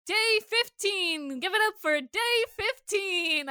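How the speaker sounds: high, slow hyped announcement; Mister Krabs announcing. Retold or imitated. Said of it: "that one Mister Krabs where he's like".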